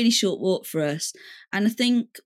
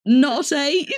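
higher, puzzled "Not eight?"; baffled question; 5 semitones higher, 4 dB louder than usual. Retold or imitated. Bar woman puzzled by the customer's order, baffled question. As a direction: query it in disbelief, half laughing. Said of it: "the woman on the bar is like".

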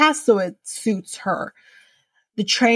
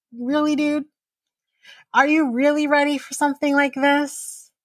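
mock disbelief; 6 semitones higher than usual. Voicing viewers' doubt about Brayden.

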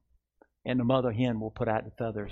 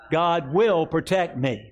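slow and higher, proclaimed for the church to echo; slow, raised declaration; 5 semitones higher, 4 dB louder, slower than usual. Shown as a demonstration. Pastor leading the congregation in a slow proclamation. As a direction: proclaim it slowly and strongly. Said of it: "Say this with me".

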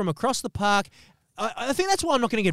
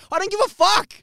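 high, louder fed-up snap; high, louder, exasperated rant; fed-up outburst; 7 semitones higher, 3 dB louder than usual. Acted. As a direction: blurt it out like you've had enough.

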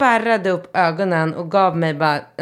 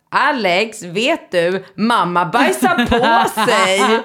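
lightly voiced little boy, slow and louder; a kid gleefully telling on mum; child's tease; 5 semitones higher, 4 dB louder, much slower than usual. Son announcing at dinner table, slow, louder mock child. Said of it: "jag tror det var Rambo som sa det".

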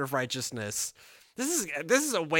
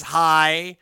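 playful Jesus voice; 2 semitones higher, 9 dB louder, slower than usual. Pretend. Voicing Jesus jokingly.

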